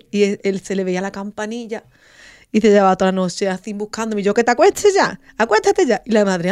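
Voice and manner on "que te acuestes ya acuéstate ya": high, loud scolding to go to bed; exasperated order. Retold or imitated. Mother ordering her sleepless son to bed, exasperated. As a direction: order the child to bed, fed up.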